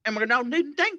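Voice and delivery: quick, lightly drawled Appalachian accent demo; a hint of an Appalachian Mountain accent, mocking it a little. Shown as a demonstration. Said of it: "Appalachian Mountain accent".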